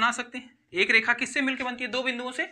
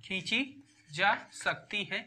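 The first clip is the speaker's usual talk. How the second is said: word by word with long pauses; halting write-along delivery, phrase by phrase; dictating; slower than usual. Real, not acted. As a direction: dictate slowly while writing it out. Dictating answer while writing it out.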